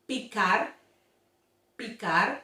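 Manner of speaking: picar with short pure i; model Spanish. Shown as a demonstration. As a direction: picar deutlich, kurzes i. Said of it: "For example".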